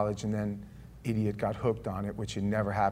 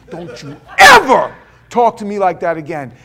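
much louder warning; angry shout; 8 semitones higher, 19 dB louder than usual. Retold or imitated. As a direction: bark it as a final warning. Threatening Danny against wall.

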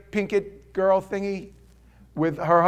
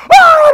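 very high, loud mock wail; mock crying wail; 21 dB louder than usual. Preacher imitates couple crying at the trick, mock wail. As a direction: burst into exaggerated bawling. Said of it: "and they start crying".

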